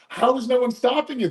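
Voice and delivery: lightly voiced, high and incredulous; mock surprised question. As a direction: toss it up high like you can't believe it. Retelling a friend's surprised question.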